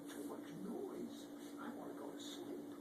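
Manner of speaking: softly whispered, wanting sleep; whispered tired complaint; sleepy whisper. Acted. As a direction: hush it like a drowsy bug.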